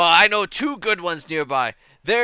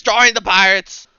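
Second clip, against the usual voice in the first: burly crier shouting; 6 semitones higher, 9 dB louder than usual. Acted. Burly fella on a milk crate recruiting pirates, shouting. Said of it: "snaps over to you and goes".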